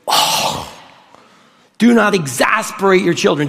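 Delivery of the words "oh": demonstrative breathy huff; long exasperated exhale; exasperated sigh. Shown as a demonstration. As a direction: lead everyone in a voiceless sigh. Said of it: "Do that with me".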